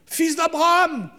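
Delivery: high, slow, far-off call; distant calling voice. Pretend. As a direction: call out from far away like a god. Voix lointaine de Dieu appelant. (voix lointaine qui appelle)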